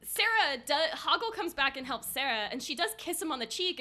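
ordinary talk, nothing marked

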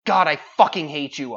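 loud furious curse; swearing angrily. Acted. Poet cursing the raven in the poem, loud mock fury. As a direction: spit it out in mock rage. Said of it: "I swore".

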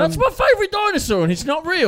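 high, loud wail of indignation; outraged protest. Real, not acted. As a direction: cry out as if betrayed. Mock outrage at Triceratops news.

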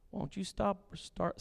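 ordinary delivery

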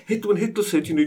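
ordinary talk

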